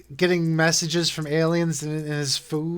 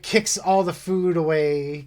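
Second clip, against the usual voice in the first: ordinary talk, nothing marked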